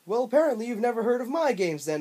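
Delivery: high, loud cartoon bobcat voice; obnoxious Bubsy voice. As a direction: ham up an obnoxious cartoon cat. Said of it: "had the most obnoxious voice I've ever heard".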